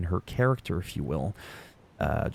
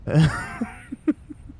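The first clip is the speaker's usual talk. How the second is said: short laugh; 6 semitones higher than usual. Real, not acted. Laughing at a clip.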